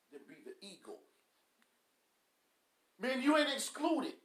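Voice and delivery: ordinary talk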